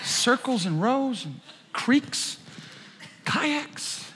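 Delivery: soft, high, laughing confusion; mock the bewildered congregation; a touch of mock confusion; puzzled listener. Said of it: "You're like".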